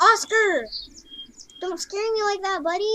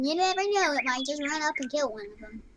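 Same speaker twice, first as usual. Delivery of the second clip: ordinary delivery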